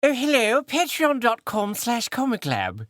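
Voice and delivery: high, drawn-out put-on British accent. Shown as a demonstration.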